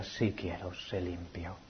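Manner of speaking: very soft and low; hushed longing. Acted. Preacher longing to hear heaven's answer, hushed. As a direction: fade to a breath.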